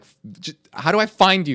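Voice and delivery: stammer, then loud and high; frustrated cry at the search; exasperated. Retold or imitated. Can't find the poem online.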